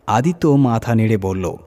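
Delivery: usual speaking voice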